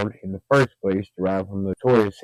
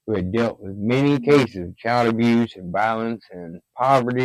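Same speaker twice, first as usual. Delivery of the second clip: ordinary delivery, nothing marked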